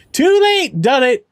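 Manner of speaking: high, loud burst of glee; excited outburst. Real, not acted. Answering the worried friend, a loud excited blurt.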